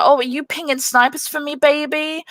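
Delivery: a hint of a smug sexist gamer; condescending. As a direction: talk down to her like a pet. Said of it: "really condescending".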